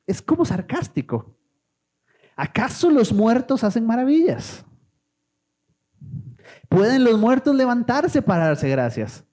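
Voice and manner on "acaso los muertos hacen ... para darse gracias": sarcastic tone. Acted. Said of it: "Es en el tono que lo está diciendo".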